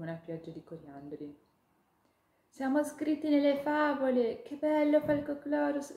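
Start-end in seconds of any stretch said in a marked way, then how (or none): 2.5-6.0 high, loud tiny-creature voice, bubbling over; a little ladybug who can't contain her joy; thrilled ladybug. Acted. Ladybug telling the hawk they're in the fables, high and thrilled. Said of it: "La coccinella vibrava dall'eccitazione".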